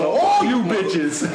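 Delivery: slow, drawn-out hurt snarl; mock Denzel snarl. Host laughing at his own Denzel bit, slow snarl. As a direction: drag it out like Denzel in Training Day. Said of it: "Denzel Washington Training Day type".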